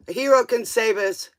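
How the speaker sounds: usual speaking voice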